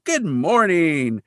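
high, slow, drawn-out cheery greeting; good-mood greeting. Retold or imitated. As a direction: sing out a greeting, imitating high spirits. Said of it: "he's still in a good mood".

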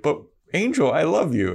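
high, pleading heroine imitation; mock lovesick plea. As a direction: plead after a departing lover, hammed-up. Acting Buffy pleading as Angel leaves.

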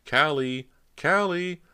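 high, loud calling shout. A narrator's reading. Cody shouts for her missing sister, high full call. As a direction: shout the name through cupped hands. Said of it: "and shouted".

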